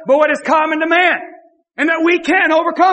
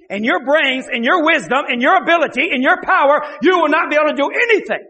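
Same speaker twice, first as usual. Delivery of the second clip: ordinary talk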